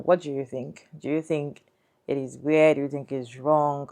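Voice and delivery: ordinary talk, nothing marked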